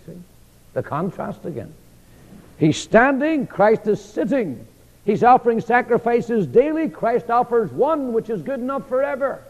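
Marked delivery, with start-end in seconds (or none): 2.6-9.4 rising fervour. Acted. Contrasting priests with Christ.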